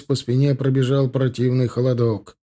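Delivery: ordinary delivery, nothing marked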